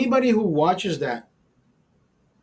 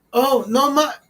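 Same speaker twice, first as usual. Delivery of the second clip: much higher cut-off Spanish exclamation; excited exclamation; 8 semitones higher, 4 dB louder, slower than usual. Retold or imitated. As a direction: blurt it out eagerly.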